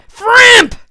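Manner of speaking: loud high goblin shout. Acted. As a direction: bellow it out. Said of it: "shouted the Goblin King".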